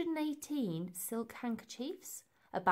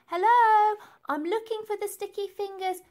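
put-on high, loud girl's voice calling into the dark; Elsie, nervous girl; 12 semitones higher, 11 dB louder than usual. A narrator's reading. Elsie searches the dark tower, calling out high and loud. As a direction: ask the empty room, hoping someone answers.

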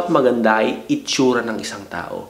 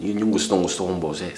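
smooth sexy voice; 7 semitones lower, 6 dB quieter than usual. Shown as a demonstration. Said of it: "smooth pero sexy".

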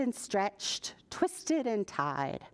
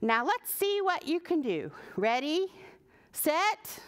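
excited; 6 semitones higher than usual. A narrator's reading. Pearl starts the race.

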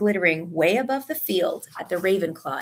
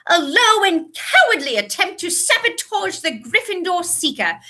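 high, indignant scolding; furious shouting; 8 semitones higher than usual. A narrator's reading. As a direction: professor thundering out punishments. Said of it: "she was shouting".

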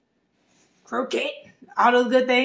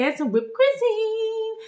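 giddy gush; 11 semitones higher, much slower than usual. Real, not acted. Gushing over the toppings.

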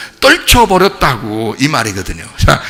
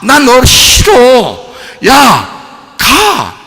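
loud, slow, high command to leave; shouting; 7 semitones higher, 5 dB louder, much slower than usual. Acted. Preacher showing the congregation how to shout trouble away. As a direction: drive it away with each word. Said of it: "이러라고요".

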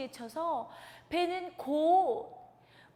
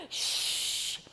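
whooshing wind. Acted. Wind sound effect.

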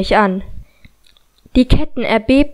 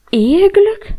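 incredulous; 7 semitones higher than usual. Acted. Incredulous echo of word.

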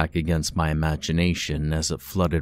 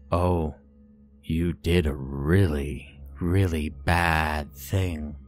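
put-on slow, silky, sad eerie boy; creepy child voice; slower than usual. A narrator's reading. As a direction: drag the words out, sorrowful and eerie. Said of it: "his voice like wet silk".

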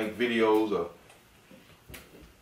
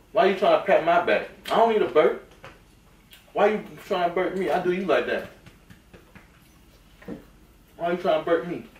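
high-pitched, mildly indignant complaint; playful mock protest; 8 semitones higher, 4 dB louder than usual. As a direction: protest in mock offence to a toddler, voice raised high. Playful protest at child patting back.

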